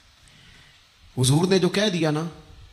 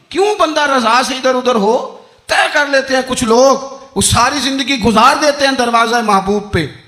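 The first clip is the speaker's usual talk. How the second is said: preaching at full force; 8 semitones higher, 7 dB louder than usual. Acted. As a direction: build the list to a fervent climax. Preacher rises again. (जोशीली तक़रीर, ऊँची और बुलंद आवाज़)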